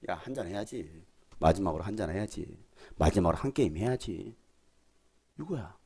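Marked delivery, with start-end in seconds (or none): 0.1-4.3 low, lightly voiced drinking pal; casual drinking buddy. Friend luring a churchgoer to one last drink, low casual coaxing. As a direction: coax in a low, lightly acted voice.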